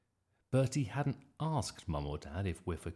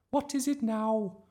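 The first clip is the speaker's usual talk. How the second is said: slow, very high, loud complaint; exasperated teacher; 8 dB louder than usual. A narrator's reading. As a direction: ask it in high-pitched exasperation. Teacher interrupted once more.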